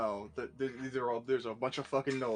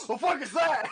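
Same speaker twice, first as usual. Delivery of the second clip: high startled outburst; 10 semitones higher, 7 dB louder than usual. Retold or imitated. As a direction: blurt it in shock. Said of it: "He just wakes up".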